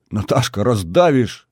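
plain reading voice

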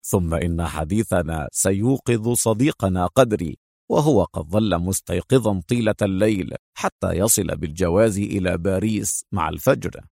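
low-voiced, amused man; easygoing, fond agent. Agent joking that they will wake their friend, low and easy.

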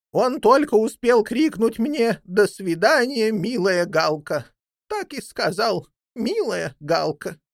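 high-pitched talking bird telling her tale; old jackdaw, earnest. Acted. Old jackdaw reporting to her master about his jailed son, earnest. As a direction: report eagerly in a high character voice.

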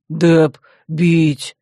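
slow, heavy brute growl. A narrator's reading. Dep beats his chest, growling threat. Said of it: "прорычал".

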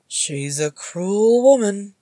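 slow, drawn-out commiseration; lilting, teasing pity; mock sympathy. A narrator's reading. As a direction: cluck sympathetically to a baby. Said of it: "clucking in sympathy".

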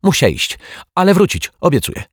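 very high foreign guest voice; high Turk character in broken Polish; Turkish visitor. Acted. Turk makes hasty excuse to flee.

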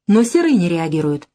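ordinary delivery, nothing marked

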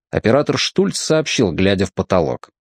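plain reading voice

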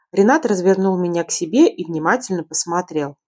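ordinary delivery

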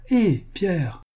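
high call, no real shout. A narrator's reading. Servant calls Pierre from the house, calling out. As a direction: call a name across a yard, pitched well up. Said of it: "cria-t-elle".

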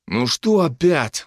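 slow, tired sighing question; weary sigh. Acted. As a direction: sigh it out wearily. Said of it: "вздохнул Дарт".

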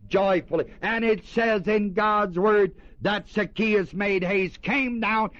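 rising, fervent preacher delivery; preaching. Acted. Preacher urging his congregation, full fervent preaching.

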